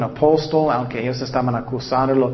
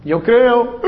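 higher, louder mock "Yo creo"; hammed-up shallow believer; 8 semitones higher, 5 dB louder than usual. Preacher mocking his old weekend faith, higher and louder.

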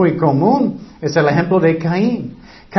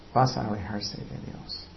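much softer, lower repeated warning; quiet echo; 8 semitones lower, 13 dB quieter than usual. Real, not acted. Preacher echoing the warning to the congregation, much softer. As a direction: let the warning sink, hushed.

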